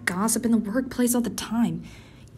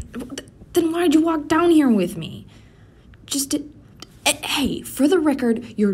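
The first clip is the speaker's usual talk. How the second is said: higher and louder, caught out; flustered outburst; 6 semitones higher, 5 dB louder than usual. Acted. Caught out by her coworker at the vending machine, a high flustered outburst. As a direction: blurt it, embarrassed and defensive.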